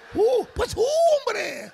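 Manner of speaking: high, loud, slow hooting; hooting owl man. Retold or imitated. Preacher mocks men as owls, high loud drawn-out hoot.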